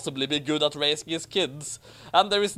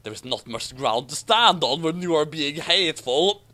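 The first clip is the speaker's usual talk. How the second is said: ordinary talk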